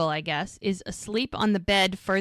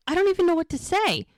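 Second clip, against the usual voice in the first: high, louder, fast flustered shock; 9 semitones higher, 4 dB louder, faster than usual. Retold or imitated. Mel stunned by friend's news, fast high flustered shock. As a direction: blurt it out, overwhelmed.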